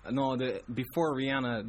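normal talking voice

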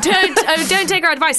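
high, loud laughing echo. Real, not acted. Echoing with laughter.

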